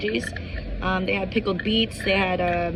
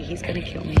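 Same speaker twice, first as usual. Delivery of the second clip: usual speaking voice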